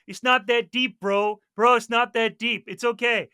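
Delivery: calm him down in a put-on American accent. Mars player soothing his teammate, American bro accent. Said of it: "He was American".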